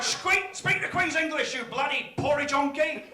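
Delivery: high, loud indignant tirade; aggressive mock shout. Londoner insulting a Scottish hotel guest, angry high shout. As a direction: bellow it, stretching the final insult.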